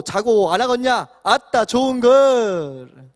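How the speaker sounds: loud, high, drawn-out Jeolla dialect. Shown as a demonstration. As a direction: build to a big Jeolla-dialect flourish. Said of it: "전라도 버전 한번 읽어드리겠습니다".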